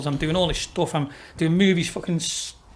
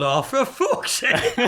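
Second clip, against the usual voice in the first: high, stretched-out groan of a quote; mock dismay, laughing; 6 semitones higher, much slower than usual. Young singer watching his crowd walk out, mock dismay with laughter. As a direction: imitate exasperation through laughter.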